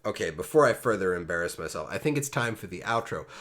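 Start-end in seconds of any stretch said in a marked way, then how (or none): none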